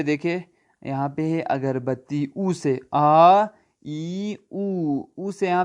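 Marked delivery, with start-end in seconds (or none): none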